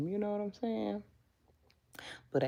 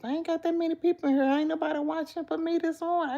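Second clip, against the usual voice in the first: high fast complaint; 10 semitones higher, faster than usual. Real, not acted. Complaining about few viewers.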